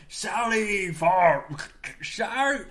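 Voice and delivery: loud, drawn-out undead war cry; movie skeleton. Acted. Host acting a skeleton from a horror film, drawn-out cry. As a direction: stretch it out like a skeleton commander. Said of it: "the skeleton from Army of Darkness".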